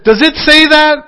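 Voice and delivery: high, loud call to the congregation; fired-up preacher. Retold or imitated. Minister calling out to his congregation, loud and high. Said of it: "And he said".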